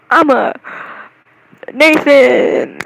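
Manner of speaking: loud, drawn-out hammed-up shout; exaggerated loud proclamation; mock declaration. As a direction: belt it out in mock protest. Mock outburst declaring fan identity.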